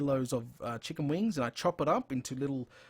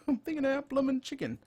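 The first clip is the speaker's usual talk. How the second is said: fast, much higher, laughing through the words; laughing repeat; 11 semitones higher, faster than usual. Real, not acted. Joking to viewers about craving chicken, laughing. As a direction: repeat it again, cracking up at yourself. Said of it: "Crikey, I'm thinking about bloomin' chicken now".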